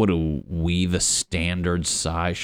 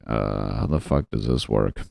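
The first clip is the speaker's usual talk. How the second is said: low, dopey puzzlement; mock baffled; 9 semitones lower than usual. Toilet user facing a clog, low mock bafflement. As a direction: drop the pitch and play clueless.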